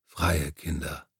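a deep, slow rumble of a big man. A narrator's reading. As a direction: Pana quietly adding his line to Isaac's speech, deep voice. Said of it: "Panas tiefe Stimme".

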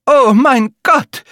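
high, slow, drawn-out gasp of alarm; old woman's horrified exclamation; old woman's cry. Acted. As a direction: let it out like a frightened old lady. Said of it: "sagte die alte Frau".

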